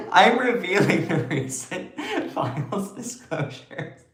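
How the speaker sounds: laughing. Real, not acted. Streamer restarting an announcement to viewers, laughing. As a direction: try to get it out through laughs. Laughing, then retakes it composed.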